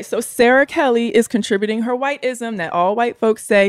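ordinary talk

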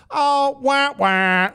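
high, whiny fake boo-hoo; mock crying. Host mocking skater's tears with fake crying. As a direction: mockingly wail like a crybaby. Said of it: "broke down in tears on the ice".

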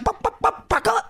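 high clucking that ends in a name; chicken cluck. Shown as a demonstration. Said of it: "He shoulda came with, like".

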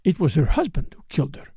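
plain reading voice

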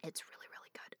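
very soft, unvoiced whisper; hushed whisper. Real, not acted. Wine drinker confiding to a co-host, hushed whisper. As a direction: whisper it, savouring the taste.

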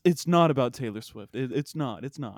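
usual speaking voice

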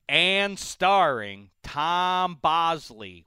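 slow, drawn-out credits voice; mock TV credits. Host mimics the Happy Days credits, slow and grand. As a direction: stretch it out like closing credits. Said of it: "at the end, a special category".

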